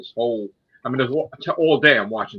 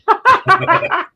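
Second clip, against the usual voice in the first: high burst of laughter; loud laugh; 10 dB louder than usual. Real, not acted. Friend leaves to get Chris.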